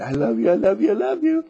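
loud, high gushing "I love you"; loving coo. Shown as a demonstration. Gushing love to a seed in the dirt, loud and high.

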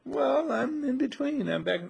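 slow, high, hammed-up dithering; mock hesitation. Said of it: "And you say".

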